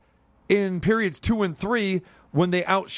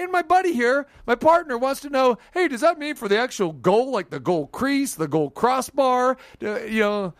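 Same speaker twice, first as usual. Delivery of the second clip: mock eager question to announcer; 7 semitones higher than usual. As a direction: play it out as if already turned to the guy in the booth. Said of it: "Then I say".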